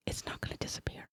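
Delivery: firm hushed whisper. Acted. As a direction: whisper back to calm her. Sneaking near portal, hushed talk.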